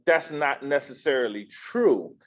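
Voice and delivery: ordinary talk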